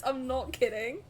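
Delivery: ordinary talk, nothing marked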